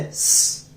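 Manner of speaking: स hissed with no vowel, a bare unvoiced sss; just the hiss of स, no vowel at all; half s sound. Shown as a demonstration. Teacher to students, showing half स as a bare hiss. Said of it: "बोल के देखिए".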